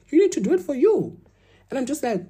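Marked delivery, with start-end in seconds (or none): 0.1-1.2 put-on high-pitched, emphatic African-accented dad; dad's African voice. Quoting dad's constant advice, in a high African accent. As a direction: imitate an African father laying down the rule. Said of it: "what my dad says in his African voice".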